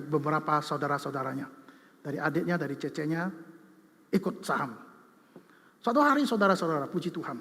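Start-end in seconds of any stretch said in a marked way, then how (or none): none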